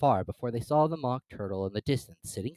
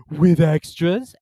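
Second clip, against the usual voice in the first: a little anxiously; 6 semitones higher, 12 dB louder, much slower than usual. Acted. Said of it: "a little anxiously".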